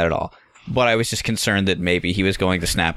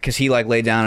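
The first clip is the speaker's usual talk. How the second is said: ordinary talk, nothing marked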